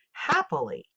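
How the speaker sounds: "Happily" as HAP-pi-ly, HAP pitched high; first-syllable stress. Shown as a demonstration. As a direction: punch the start of "Happily". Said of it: "first syllable".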